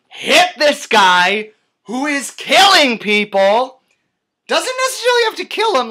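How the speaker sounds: loud, high-pitched ranting; exasperated outburst. Real, not acted. Film reviewer ranting about Batman not hitting the Joker, exasperated. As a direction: shout it out in disbelief.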